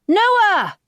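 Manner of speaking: loud, high call into the dark. A narrator's reading. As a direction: shout his name one last time. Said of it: "she calls out one more time".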